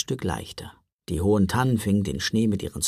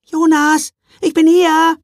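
little sister calling; 11 dB louder than usual. A narrator's reading. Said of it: "hörte er aus dem letzten Abteil ihre Stimme".

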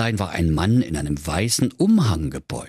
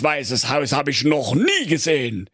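high, put-on voice with a laugh; a giddy king, sure of success; 7 semitones higher than usual. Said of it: "sprach König Fugenkäse".

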